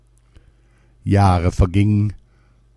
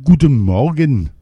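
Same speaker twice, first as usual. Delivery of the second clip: higher, louder royal morning greeting; king's greeting; 6 semitones higher, 5 dB louder than usual. A narrator's reading. King answering his maid in the morning, high and loud. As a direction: greet back like a dazed king. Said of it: "erwiderte der König".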